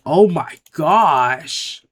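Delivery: loud, drawn-out cry of disbelief; exasperated outburst. Real, not acted. Player reacting to the game's bad luck, loud exasperation.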